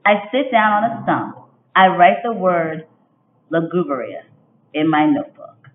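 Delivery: expressive picture-book read-aloud; reading to children. A narrator's reading. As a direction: read it like storytime for young kids. Teacher reads picture book aloud.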